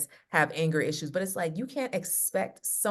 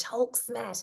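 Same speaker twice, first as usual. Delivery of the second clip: Hulk voice; 5 semitones higher than usual. Pretend. Voicing the Hulk again.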